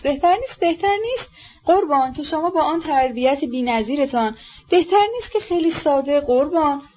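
high, fawning servant's wheedle. A narrator's reading. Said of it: "با شکلک عجیبی حاکی از تملق".

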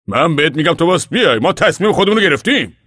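father loudly ordering his defiant wife to come; angry father. Acted. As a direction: scold her to make her come. Pa insists on decision.

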